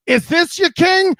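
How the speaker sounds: loud, high, drawn-out taunting question; mock challenge. Podcast host taunting fans over their quarterback, mock challenge.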